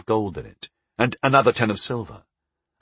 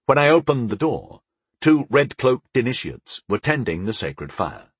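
ordinary delivery, nothing marked

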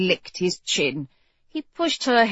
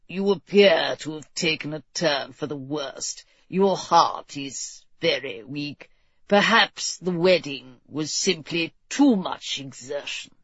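low, measured doctor's delivery; lightly played physician, grave news in a lower voice; lightly voiced doctor; 6 semitones lower than usual. Doctor tells the king he has worsened, lightly voiced and low.

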